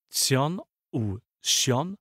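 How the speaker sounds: ordinary talk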